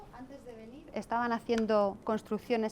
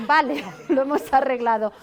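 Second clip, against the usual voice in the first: laughing through the words, louder; amused; 3 semitones higher, 9 dB louder than usual. Retold or imitated. Teacher privately amused by the girl's correction, laughing. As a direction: chuckle at the child's logic.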